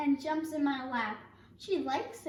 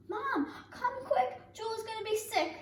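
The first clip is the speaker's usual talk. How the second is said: alarmed call; 10 semitones higher than usual. Retold or imitated. Calling mom in alarm.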